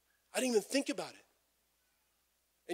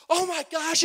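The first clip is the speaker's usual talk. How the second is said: much higher and louder, thrilled; wife excited; 12 semitones higher, 8 dB louder than usual. Retold or imitated. Wife thrilled about Palm Sunday, much higher and louder. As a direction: gush like she just heard great news. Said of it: "she's all excited".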